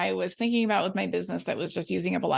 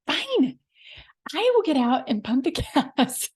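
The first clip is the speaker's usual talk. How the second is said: high, put-upon huff that breaks into a laugh; mock martyr who has to pump the gas herself; exaggerated fed-up sigh of a voice; grudging sarcasm; 5 semitones higher, 3 dB louder than usual.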